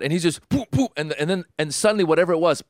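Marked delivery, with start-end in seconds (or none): 0.5-0.9 high, popped thrust noises; mock puffs forced out by squeezing; imitated Heimlich thrusts. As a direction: sound out each squeeze, high-pitched.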